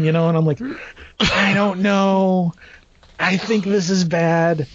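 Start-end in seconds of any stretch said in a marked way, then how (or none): 1.2-4.7 drawn-out, hesitant dread; reluctant dread. Retold or imitated. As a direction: drag the words out slowly, full of misgiving. Retells his dread to friend.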